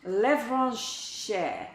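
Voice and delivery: another careful go at Levranche in French; retry for the viewer, a fake French accent on Levranche. Shown as a demonstration. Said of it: "Pronounce my name in a fake French accent".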